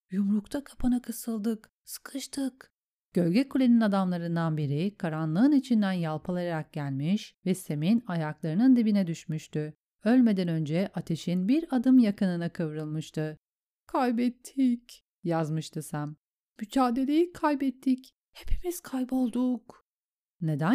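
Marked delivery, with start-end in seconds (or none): 0.1-2.7 whispered, higher, fading dispatch; written message voice. A narrator's reading. Sam writes as battle fails. 13.9-15.0 defeated message voice. A narrator's reading. Sam writes of defeat. 16.6-19.8 defeated message voice. A narrator's reading. Sam writes of defeat.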